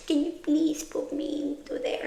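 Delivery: breathy, timid little whisper. Retold or imitated. Mocking her embarrassed self, asking her partner in a tiny whisper. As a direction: whisper it like a shy, embarrassed plea.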